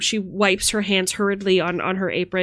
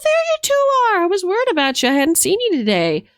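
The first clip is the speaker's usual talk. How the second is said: maid's voice; 12 semitones higher than usual. Acted. Maid greets the two children.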